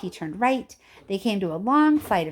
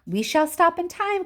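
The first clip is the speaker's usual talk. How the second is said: called out; 9 semitones higher than usual. A narrator's reading. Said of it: "called Mister Wonka".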